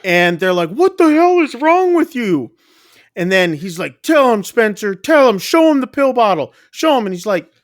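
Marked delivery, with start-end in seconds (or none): 0.7-2.5 high, loud imitated outburst; retold angry yell, pitched way up; outraged demand. Parents' outrage at man's act. 4.0-7.1 high, rising imitated plea; retold argument, pitched up and insistent; frantic urging. Man pleads frantically in argument.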